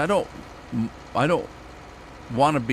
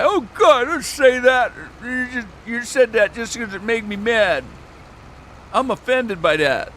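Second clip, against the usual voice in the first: high, loud, whiny complaint; mock offended person; 11 semitones higher, 4 dB louder than usual. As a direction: ham up an outraged snowflake. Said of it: "I come in and say".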